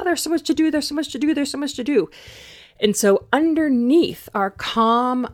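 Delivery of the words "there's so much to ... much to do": much higher, rushed and panicky; frantic inner voice. Acted. Coach voices the anxious monger, mock frantic and much higher. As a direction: repeat it like a mind spinning out of control.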